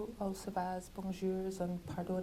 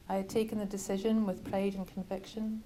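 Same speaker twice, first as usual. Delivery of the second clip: plain reading voice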